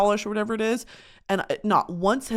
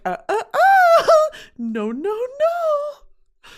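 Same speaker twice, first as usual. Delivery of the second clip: much higher and louder, slow stutter; hammed-up frantic refusal; 5 dB louder, much slower than usual. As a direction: refuse in mock panic. Refusing her skin's plea.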